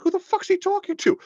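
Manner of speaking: very high, loud squeaky outrage; mock incredulous squeal. Imitating a baffled viewer of Boehner, shrieking very high. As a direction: shriek it in disbelief.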